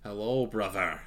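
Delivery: a faint attempt at a Scottish accent, half-hearted; lightly voiced Scottish greeting. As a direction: try a Scottish Desmond, not quite landing it. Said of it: "I can't do a Scottish accent".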